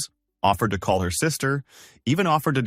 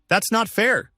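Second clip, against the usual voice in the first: screaming; 12 semitones higher, slower than usual. A narrator's reading. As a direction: shout it as an outburst. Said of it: "she screamed".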